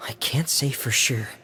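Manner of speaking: usual speaking voice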